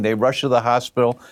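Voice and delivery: normal talking voice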